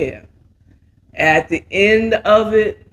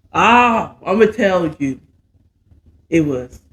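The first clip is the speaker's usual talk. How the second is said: ordinary delivery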